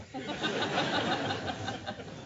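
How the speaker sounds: a soft airy laugh at his own joke; breathy laugh. Real, not acted. Grandfather telling a story at a ceremony, soft laugh. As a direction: let out a quiet breathy chuckle.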